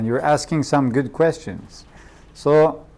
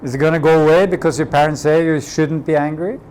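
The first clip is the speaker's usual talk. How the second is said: ordinary talk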